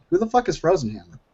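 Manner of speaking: normal talking voice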